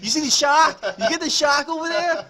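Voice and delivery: loud, high-pitched Boston shouting; Boston accent. Retold or imitated. Friend quoting a viral video, shouted Boston accent. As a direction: mimic an overexcited Boston guy yelling. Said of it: "Oh, that fucking video".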